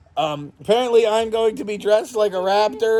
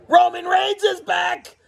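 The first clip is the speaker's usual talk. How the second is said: high, slow hype shout; stunned fan yelling; excited shout; 9 semitones higher, slower than usual. Real, not acted. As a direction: announce it like a thrilled fan. Surprise return of Roman Reigns.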